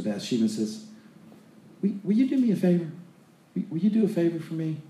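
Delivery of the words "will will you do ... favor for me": high, quick, mock-timid request; hammed-up sheepish asking; pleading son. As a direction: wheedle for a favor in a raised voice. Acting Adonijah wheedling a favor from Bathsheba.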